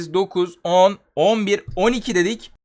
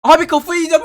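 loud exasperated cry, pitched up; frustrated yell; 10 semitones higher, 8 dB louder than usual. Real, not acted. Gamer losing to a stalling boss, frustrated yell.

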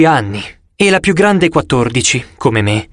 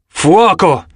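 higher-pitched firing order; commanding; 4 semitones higher than usual. A narrator's reading. Zoe orders the archers to shoot, commanding. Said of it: "ordinò Zoe".

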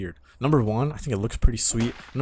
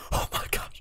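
voiceless, softer gasp with a laugh; breathless disbelief; 6 dB quieter than usual. Real, not acted. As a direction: lose your voice in amazed laughter. Overwhelmed at spotting former tribemate.

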